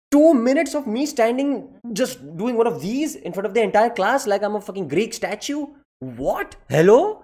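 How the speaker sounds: as if it can't be true; outraged disbelief. Real, not acted. Comic telling his outrage at a two-minute pose, incredulous.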